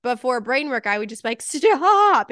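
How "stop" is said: one sudden, much higher, louder shouted word; sharp yell. Retold or imitated. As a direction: snap it out like an overstimulated mom at the end of her rope. Yelling at touchy son before.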